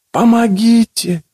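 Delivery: slow, high, distant plea for help; a muffled voice from the dark; faint eerie voice. A narrator's reading. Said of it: "раздался неясный голос".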